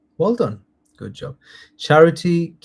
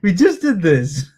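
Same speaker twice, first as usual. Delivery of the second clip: laughing; 7 semitones higher, 5 dB louder than usual. Real, not acted. Laughing, teasing a second answerer.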